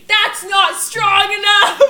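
mock drill-sergeant yell from mom, drawn out; imitated mom's yell. Daughter re-enacting mom coaching her to say no, yelling.

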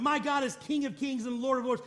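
ordinary delivery

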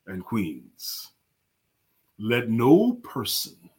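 deep, very slow, booming pauses between phrases; Mark Greer voice. Retold or imitated. Host imitating his friend Mark Greer's deep slow voice for the audience. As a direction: drag each phrase out like a big radio baritone. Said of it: "I've heard Mark Greer speak, and it would sound like this".

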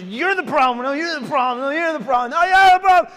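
mock screaming match. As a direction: replay the fight, building to a full scream. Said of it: "we're screaming".

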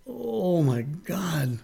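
soft, slow, drawn-out awe; overcome. Retold or imitated. Teacher losing it on seeing student's progress, overcome. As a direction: breathe it out slowly, overcome. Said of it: "I lost it".

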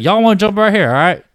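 high, loud hillbilly twang imitation; hillbilly kid accent. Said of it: "straight up hillbillies".

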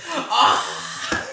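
high comic yell through a laugh; mock yell. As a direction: yelp as if thrown off, half laughing. Acts out rider thrown off motorcycle.

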